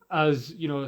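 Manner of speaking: ordinary talk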